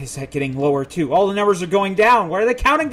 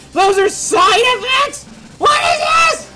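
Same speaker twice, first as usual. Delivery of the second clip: much higher, loud shouted shriek; shrieking panic; 8 dB louder, slower than usual. Real, not acted. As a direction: yell it in disbelief. Overwhelmed by side effects screen.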